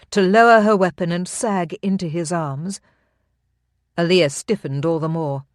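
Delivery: ordinary delivery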